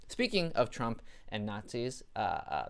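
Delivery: ordinary delivery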